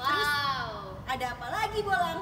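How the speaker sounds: loud, high hype question; booming prize announcer. Acted. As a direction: pump up the crowd for the next prize. Hyping the next prize.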